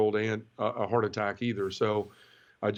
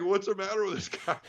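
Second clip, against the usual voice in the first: higher, mock bewildered nun; puzzled nun; 9 semitones higher than usual. Nun wondering about the man at wheel, mock bewildered, higher. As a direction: lightly voice her baffled thought. Imagining the nun's thought.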